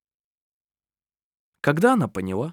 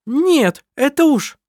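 loud, very high protest; indignant shout; 7 dB louder, slower than usual. Acted. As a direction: burst out indignantly. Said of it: "начала была Лисин".

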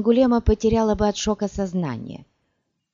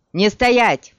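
louder, raised urgent shout; shouted order; 4 semitones higher, 7 dB louder than usual. A narrator's reading. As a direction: shout it to get them moving. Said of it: "крикнул он".

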